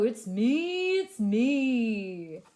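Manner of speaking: lightly voiced cow. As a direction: say it like a big cow. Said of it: "says the cow".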